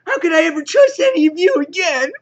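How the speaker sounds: very high, loud hammed-up wail; mock crying. Betrayed X-Man turning on her team, mock crying. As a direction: squeal it like a whiny crybaby. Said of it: "she starts crying".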